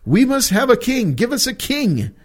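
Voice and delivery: high-pitched mock demand for a king; a people pleading high and insistent for a king. Acting out Israel demanding a king.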